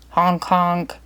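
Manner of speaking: voiced horn blast, two beeps; mock car horn honk; bus horn. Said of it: "The bus driver sounded his horn".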